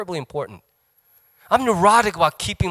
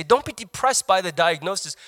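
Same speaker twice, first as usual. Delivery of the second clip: ordinary delivery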